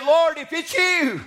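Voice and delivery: high, drawn-out preacher's holler. Acted. Peter calls to Jesus from the boat, hollering. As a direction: call it out slowly across the water. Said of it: "Peter hollered".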